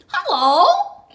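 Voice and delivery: loud, high bright dog voice; loud high dog hello; barking dog greeting. Acted. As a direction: bark it out as the big red dog. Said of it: "she barked".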